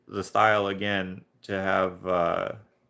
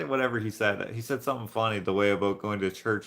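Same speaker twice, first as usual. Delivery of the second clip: usual speaking voice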